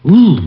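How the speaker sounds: a high, loud ooh of cold ache; pained ooh. Acted. Frozen detective aching as he stands, loud high ooh. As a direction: let the frozen ache out in one sound.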